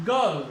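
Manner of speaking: गर्ल said with no r sound; गर्ल, the r dropped before l; girl without r. Shown as a demonstration. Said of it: "ए और रे यदि mix". (गर्ल बिना र के)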